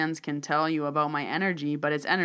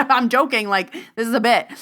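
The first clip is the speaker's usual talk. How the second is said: loud, higher-pitched insistence; retold protest; 7 semitones higher, 9 dB louder than usual. Palm reader refusing the man's cash, loud protest. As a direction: protest loudly through the joke.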